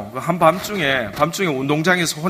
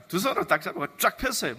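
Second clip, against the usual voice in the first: usual speaking voice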